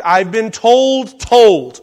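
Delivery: "told, told", both words slow and heavily stressed; double emphatic. Shown as a demonstration. Showing the doubled verb, "told, told" stressed twice. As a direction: lean hard and slowly on each "told". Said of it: "So it's double emphatic".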